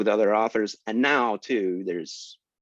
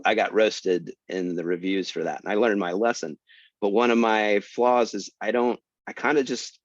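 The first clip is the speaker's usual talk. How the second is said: ordinary delivery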